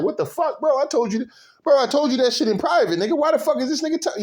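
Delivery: indignant mock rant, fast at the end; mock outraged friend. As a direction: throw out a sharp, incredulous what-the-hell. Acting out betrayed friend's outburst.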